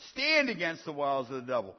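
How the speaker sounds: usual speaking voice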